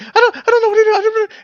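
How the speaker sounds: very high, loud, frantic; panicking girl. Acted. Sammy overwhelmed by panic, very high and loud. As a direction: cry out in helpless fear. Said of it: "She's just panicking".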